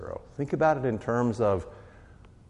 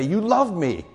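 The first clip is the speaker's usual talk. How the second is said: amazed delight; 10 semitones higher, 6 dB louder than usual. Acted. Believer answers God, amazed.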